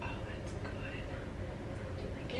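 soft unvoiced whisper. Real, not acted. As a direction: breathe it almost silently. Quiet remark during styling.

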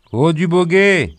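high, loud call out into the night; distant call. A narrator's reading. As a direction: hail someone from far off in the fog. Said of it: "éleva une voix". (appel lancé au loin, fort et aigu)

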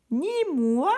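miaulement très aigu; étirez le refus en miaulant; chat qui miaule. A narrator's reading. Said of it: "miaula le chat". (le chat paresseux refuse, miaulement très aigu)

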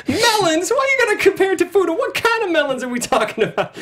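high, fast scolding wife. Retold or imitated. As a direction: mimic her indignant nagging. Imitating the wife's complaint.

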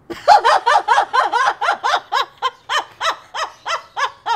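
big high laugh bursting out; loud burst of laughter. Real, not acted. Laughing at her own joke about the men.

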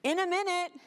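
high-pitched, put-on kid's call; stalling kid. Kid busy with video games answering parent, stalling. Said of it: "and you said".

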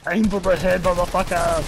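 put-on loud Russian accent shouting. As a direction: bark orders in a thick Russian accent. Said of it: "I'm doing a Russian accent".

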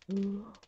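a quick sharp intake of breath; sharp gasp. Real, not acted. Streamer gasping as the opponent trolls him. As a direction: gasp in shock.

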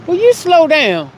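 high, loud sassy call. Real, not acted. Sassy shout at a speeding driver. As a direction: holler it at the passing car.

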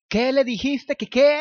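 high, loud, indignant cry; shocked yell. Acted. Tom yelling at Luke after hearing the lie, blushing. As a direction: shout it in flustered disbelief. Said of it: "gritó Tom".

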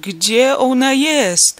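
desperate shout. A narrator's reading. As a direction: scream it in panic. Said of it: "krzyknął zdławionym głosem". (rozpaczliwy, wysoki krzyk)

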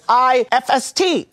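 high, slow mock Indonesian spelling; bad Indonesian accent. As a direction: spell it back letter by letter as an Indonesian caricature. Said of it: "forgive me for this bad Indonesian accent".

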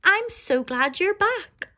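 high, slow, joyful; overjoyed father. Acted. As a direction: beam with relief. Father welcomes children home.

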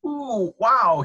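high, loud gasp of shock. A narrator's reading. Dentist entering the fox's mouth, gasping. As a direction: exclaim it high and loud, taken aback. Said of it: "he gasped".

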